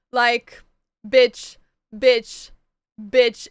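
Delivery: loud, emphatic repeated bitch; loud excited exclaiming. Real, not acted. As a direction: blurt it out, overwhelmed. Overwhelmed by book news.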